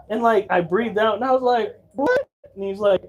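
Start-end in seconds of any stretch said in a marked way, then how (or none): 1.9-2.3 high, shocked squeak of a question; startled what. Retold or imitated. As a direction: sound stunned and lost. Confused reply voiced high.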